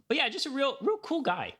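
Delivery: mock high.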